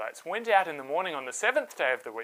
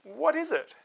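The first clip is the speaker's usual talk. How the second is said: puzzled question; 10 semitones higher than usual. A narrator's reading. People puzzled by strange stuff.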